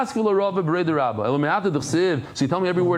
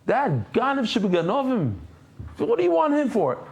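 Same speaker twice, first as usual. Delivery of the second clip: mocking townsman; 7 semitones higher than usual. Townsman scoffing at the leader, mock scorn. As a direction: spit out the insult, then scoff.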